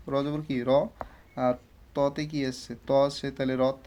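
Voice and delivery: normal talking voice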